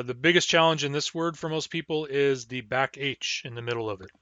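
ordinary talk

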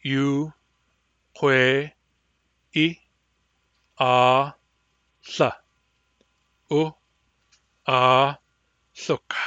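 each syllable said apart with pauses; syllable-by-syllable drill. Shown as a demonstration. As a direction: split it into separate syllables. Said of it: "By syllables".

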